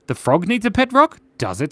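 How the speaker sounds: usual speaking voice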